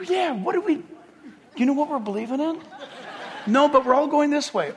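confused crowd. Pretend. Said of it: "they're all like".

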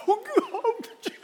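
high, hammed-up overcome "Oh, God"; mock weepy friend. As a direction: imitate a friend overcome in worship, laughing. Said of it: "And he's like".

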